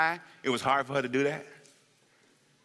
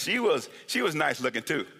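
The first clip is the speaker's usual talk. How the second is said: ordinary delivery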